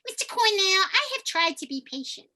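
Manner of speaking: high, nasal character voice; nasal resonance. Shown as a demonstration. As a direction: put it up in the nose, loud at first. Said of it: "my nasal resonant place".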